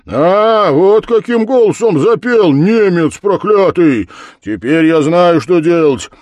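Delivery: loud, high, forceful orders; blacksmith's commanding shout; triumphant command. A narrator's reading. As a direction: crow over him, then command.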